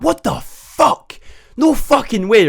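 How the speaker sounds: high, slow gasping disbelief; the whole class can't believe it; stunned disbelief. Retold or imitated.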